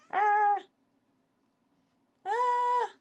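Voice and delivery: very high sudden yelp; startled cry. Real, not acted. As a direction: yelp in alarm.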